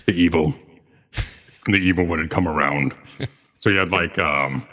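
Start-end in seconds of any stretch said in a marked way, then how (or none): 0.1-2.9 deep pitch shifted. Shown as a demonstration. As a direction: lower and stretch the words. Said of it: "oh, yes, this was".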